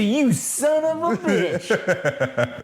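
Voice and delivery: hammed-up outraged yell through laughter; mock furious yell. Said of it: "I'd be like".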